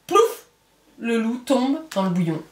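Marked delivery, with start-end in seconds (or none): 0.1-0.5 very high playful plouf; splash sound. A narrator's reading. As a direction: mimic the splash of the wolf.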